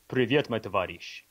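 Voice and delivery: a quick greeting in a Russian accent; casual Russian accent demo. Shown as a demonstration. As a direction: show off your Russian class. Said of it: "Your Russian accent's pretty good".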